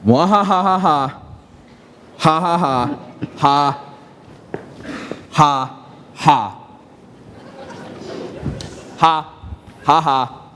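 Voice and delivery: theatrical villain cackle; evil villain laugh. Acted. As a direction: practice a menacing villain laugh. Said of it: "practice my evil villain laugh".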